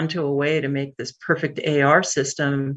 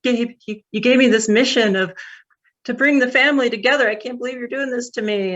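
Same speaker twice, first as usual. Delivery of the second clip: higher, pleading, a touch tearful; half-relive breaking down in front of the boss; imitating a choked-up, hurt plea to Gabe; retold tearful plea; 8 semitones higher than usual. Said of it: "immediately broke down into tears".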